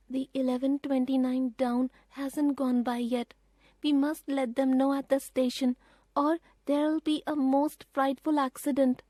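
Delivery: put-on a boy realising the danger; boy Peter, urgent. A narrator's reading. Peter warning his sisters about the train, urgent.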